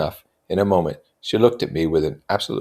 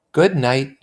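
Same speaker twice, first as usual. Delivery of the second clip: ordinary delivery, nothing marked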